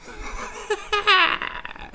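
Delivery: high-pitched cackling laugh; real laugh. Real, not acted. Host reacting to the video, high laugh. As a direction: let out a long high laugh.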